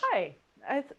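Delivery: usual speaking voice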